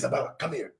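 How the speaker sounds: hushed whisper to his daughter; dad secretly whispering for his daughter to come; whispered call. Retold or imitated. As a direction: whisper so the wife won't hear.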